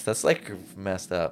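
usual speaking voice

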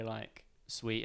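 usual speaking voice

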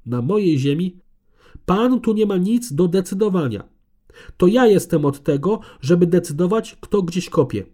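nervous, arrogant. A narrator's reading. Conservator interrupts the professor, loud and arrogant. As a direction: cut him off, high and haughty. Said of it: "a jej ton nie tracił niczego z jej wcześniejszej nerwowości i arogancji".

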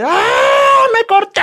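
high, loud, panicked scream; panicked yell. Retold or imitated. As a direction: scream like a kid who just saw blood. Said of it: "empecé a gritar de".

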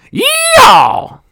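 very high, very loud yeehaw; cowboy whoop. Acted. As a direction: holler it like a rodeo cowboy. Celebrating girls kissing.

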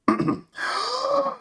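sharp gasping inhale before a long rush; big gasp for air. Pretend. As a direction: suck in a huge breath. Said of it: "do it in one breath".

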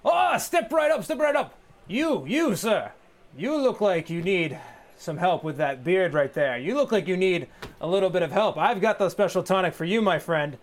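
loud, high, hammy salesman pitch; snake oil seller. Acted. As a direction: shout it like a carnival huckster. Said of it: "and he kind of goes".

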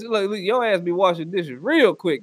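ordinary talk

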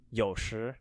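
careful demo of 有时, dip then rise; third tone, then second tone. Shown as a demonstration. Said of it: "Y O U in the third tone and S H".

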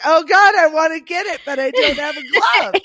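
high squealing disgust, almost laughing. Retold or imitated. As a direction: squeal it like you're grossed out. Acted disgusted quote.

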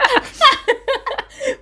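high, bursting real laughter; helpless giggling fit; big laugh. Real, not acted. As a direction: crack up at your own story. Laughing at retold scene.

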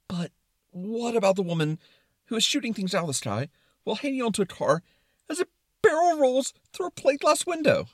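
high, mounting incredulous worry; concerned Brain. Acted. Brain questioning the barrel roll, building to high alarm. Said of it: "in a very concerned tone".

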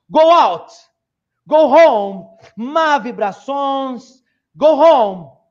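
loud, slow ritual banishing; loud proclamation. Acted. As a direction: proclaim yourself queen of good vibes. [name] announcing herself, banishing vibes. (proclamação em voz alta)

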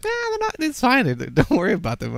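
high-pitched, casual mock dismissal; imitating friends shrugging it off; retold dismissive brush-off. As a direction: wave it away like it doesn't matter. Retelling friends' dismissive response.

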